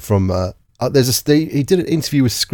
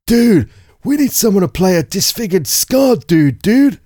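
excited, higher-pitched dude-bro exec; movie exec; 7 semitones higher, slower than usual. Acted. Movie exec pitching casting in a meeting, excited. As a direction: pitch it up like an exec hyping an idea. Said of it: "sitting in a meeting going".

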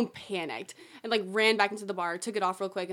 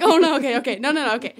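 mock panic; 2 semitones higher, 9 dB louder, faster than usual. Fake guide realizing tourists are following, comic panic. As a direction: blurt it out, half laughing at the panic.

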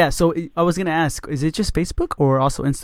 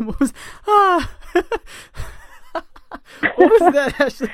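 laughing; 12 semitones higher, 3 dB louder than usual. Real, not acted. Teasing the co-host's sigh.

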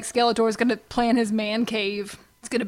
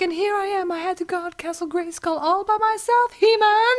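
whiny complaint; 7 semitones higher than usual. Retold or imitated. Voicing complaining Sorceress.

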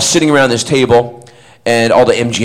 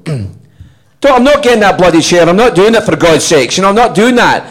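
loud, high, indignant Scottish outburst; performed Scottish tirade, pitched up and forceful; Scottish accent; 8 semitones higher, 4 dB louder than usual. Acted. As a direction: protest hotly in a Scottish accent. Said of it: "I'm gonna do it Scottish".

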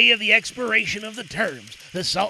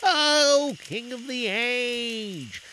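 slow, higher, fawning courtier; oily scheming vizier; 5 semitones higher, much slower than usual. A narrator's reading. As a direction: draw it out to flatter the king. Vizier addresses the Sultan.

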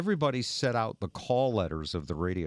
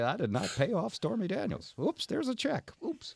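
mock politician quote; 10 semitones higher, 3 dB quieter than usual. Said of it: "the other side will say".

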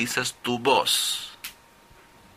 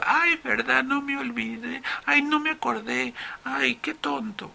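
very high pupil voice, softening; sheepish student; 13 semitones higher, 3 dB quieter than usual. Acted. Pupil admits forgetting the exception to the professor, high sheepish student voice. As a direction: squeak out a forgetful student's self-reproach. Student realises his mistake, acted.

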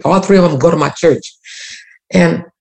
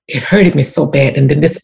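ordinary talk, nothing marked

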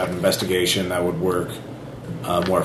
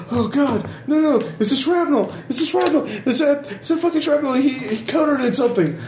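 much higher, panicked voice; terrified horror victim. Acted. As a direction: cry out in rising panic.

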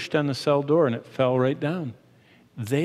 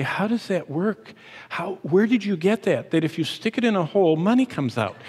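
a hint of wide-eyed children, higher; lightly voiced curious kids; 5 semitones higher than usual. Father retelling his small kids' question at the ATM, mildly higher and curious. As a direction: ask it like a puzzled little kid. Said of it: "and they'd say".

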